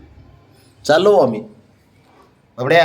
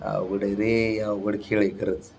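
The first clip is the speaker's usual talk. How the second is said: quiet aside; 6 semitones lower, 11 dB quieter than usual. Real, not acted. Aside after baby refuses.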